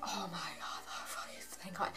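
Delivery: hushed, breathy relieved whisper; whispered relief. Retold or imitated. Fan finally arriving at the venue, whispered relief. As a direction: whisper thanks under your breath.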